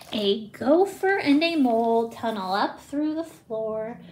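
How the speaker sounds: ordinary delivery, nothing marked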